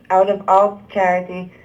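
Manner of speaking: usual speaking voice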